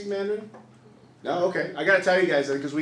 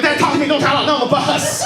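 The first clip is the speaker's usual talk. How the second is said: loud, high, hammed-up urgent plea; panicked pleading; 10 semitones higher, 10 dB louder than usual. Son begging dad on the bus, loud mock panic. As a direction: beg your dad to stop, in mock panic. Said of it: "So I'm panicking".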